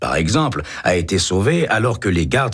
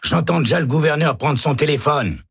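higher, hearty reassurance; encouraging inmate; 10 semitones higher than usual. A narrator's reading. Jorgensen cheering up Baca, higher pitched. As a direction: buck him up from the next cell.

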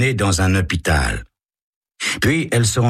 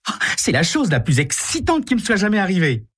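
much higher, breathless delight; thrilled girl; 13 semitones higher than usual. Acted. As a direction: gush with wonder. Carole thrilled.

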